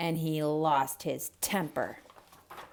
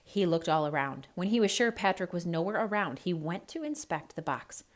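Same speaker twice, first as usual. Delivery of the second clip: ordinary delivery, nothing marked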